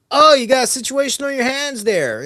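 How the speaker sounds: high, loud mock of a smug neighbor. Mimicking the smug neighbor who won't help. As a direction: sneer it up high like the know-it-all. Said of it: "he says some stupid shit like".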